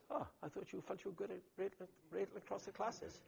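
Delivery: a light, teasing echo, quick and high; God ribbing a proud pastor; gently mocking God. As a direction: tease him back with his own words. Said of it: "God says".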